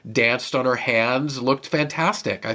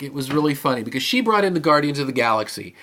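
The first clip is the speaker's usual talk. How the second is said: normal talking voice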